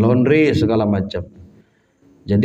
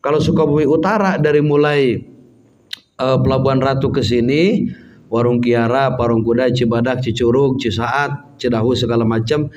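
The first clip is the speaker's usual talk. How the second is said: ordinary talk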